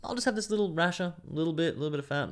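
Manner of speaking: high, prim voice mocking a small eater; dainty little eater. Himself mocking a restrained eater, high and prim. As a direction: pitch it up, dainty and fussy over one rasher. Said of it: "like Italian, I guess".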